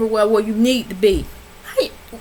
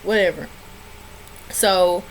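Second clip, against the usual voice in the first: ordinary talk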